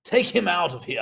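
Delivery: lightly put-on courtroom voice; a hint of pompous judge; judge ordering bailiff. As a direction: dismiss the defendant with mock authority. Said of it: "condemned to be like the bailiff".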